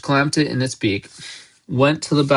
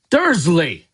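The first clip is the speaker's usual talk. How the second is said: higher, booming call of a surname; booming shout; 6 semitones higher, 3 dB louder than usual. A narrator's reading. Hagrid about to explode at uncle, booming. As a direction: boom it out like you're about to explode. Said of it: "he boomed".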